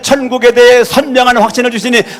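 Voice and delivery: shouted prayer, high and loud throughout; fervent loud prayer. Real, not acted. Pastor leading a shouted group prayer. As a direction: pour out each line as a fervent cry.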